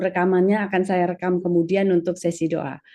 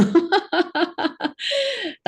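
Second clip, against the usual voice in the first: high, bubbly laughter; long high giggle; amused laugh; 11 semitones higher than usual. Real, not acted. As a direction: laugh out high at your own teasing. Host jokes about her back.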